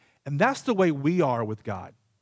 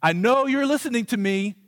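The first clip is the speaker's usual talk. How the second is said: raised, high-pitched kid's confrontation, acted out; getting louder; 8 semitones higher, 4 dB louder than usual. Child in the bathroom confronting the hidden microphone, getting louder. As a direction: act out calling their bluff, loud and high. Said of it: "I get louder and louder and louder".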